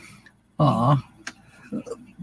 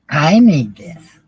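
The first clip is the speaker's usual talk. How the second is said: slow, proud mock kid voice; smug kid voice; 5 dB louder, slower than usual. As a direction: drag it out like a proud little egomaniac. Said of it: "Talk about an egomaniac".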